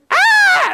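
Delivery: very high, loud mock scream of terror; panicked scream. Preacher imitating the terrified army's shriek. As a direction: shriek like a soldier running away. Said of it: "And they're all going".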